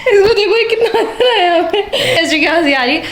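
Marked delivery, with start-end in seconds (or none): 0.1-1.8 real laughter breaking through the words; high, giggly laughing. Real, not acted. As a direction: talk while helplessly laughing. Cracking up at brother on camera.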